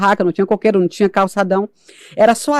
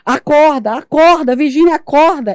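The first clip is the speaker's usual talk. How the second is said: calling to wake; 6 semitones higher, 5 dB louder, slower than usual. Retold or imitated. Calling her own body to wake.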